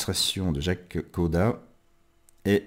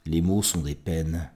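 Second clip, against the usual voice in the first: hushed, halting poetry reading; quiet, tender love-poem recital; intimate poem recitation; 4 dB quieter than usual. Acted. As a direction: let the words sink toward a whisper between long pauses.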